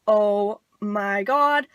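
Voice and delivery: very slow, drawn-out dread; freaking out. Retold or imitated. The kids to each other on seeing a cop, slow dread. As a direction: stretch it out in retold horror. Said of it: "we're, like, freaking out".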